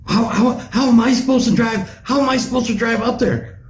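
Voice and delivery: high panicked mom voice; mock frantic mom on the phone; freaking-out mom. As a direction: squeal like a panicking mother. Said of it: "she's freaking out".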